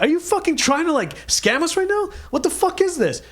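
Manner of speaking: high, incredulous accusation; worked-up retold tirade; acted outrage. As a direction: imitate an indignant guy threatening to call police. Retelling the angry guy's confrontation.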